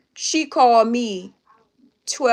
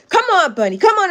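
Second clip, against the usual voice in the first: pleading coax; 10 semitones higher, 5 dB louder than usual. Retold or imitated. Niece begging her reluctant aunt to go out, high insistent pleading. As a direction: imitate nagging pleas, high and rising in force.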